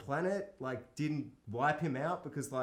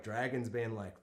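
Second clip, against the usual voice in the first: ordinary talk, nothing marked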